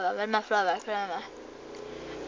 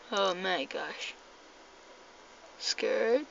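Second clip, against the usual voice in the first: usual speaking voice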